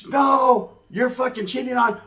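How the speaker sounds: high-pitched, indignant refusal; imitated arguing girlfriend. Girlfriend refusing to leave the house, indignant imitation. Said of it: "she's trying to argue with me".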